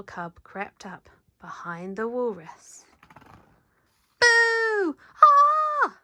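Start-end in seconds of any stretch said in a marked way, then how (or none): 4.2-5.0 sudden shouted high boo; scary boo. Acted. Cub scares the walrus. 5.2-6.0 very high loud startled shriek; shocked scream. Acted. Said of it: "The walrus was so shocked".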